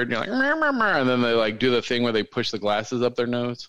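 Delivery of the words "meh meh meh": high whiny nerd babble; high-pitched mock-nerd noises. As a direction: imitate a nerd with squeaky nonsense syllables. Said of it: "you're a nerd".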